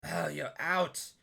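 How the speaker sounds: startled hag voice. Acted. GM voicing Baba spotting them.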